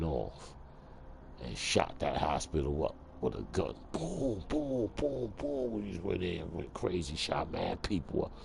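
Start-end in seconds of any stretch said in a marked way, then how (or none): 3.9-5.7 higher, quick boom boom gun sounds; imitated gunshots. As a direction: mimic the shots like a kid.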